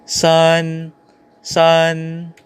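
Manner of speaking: SUN as "san", long and level; "sun" sustained in first tone; Mandarin pronunciation. Shown as a demonstration. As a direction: hold "sun" on one steady pitch. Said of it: "we have number three".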